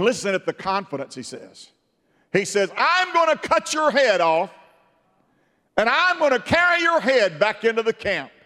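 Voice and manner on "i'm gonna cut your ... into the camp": high, loud mocking boast; loud cocky challenge to a giant; David's taunt. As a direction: shout the giant down.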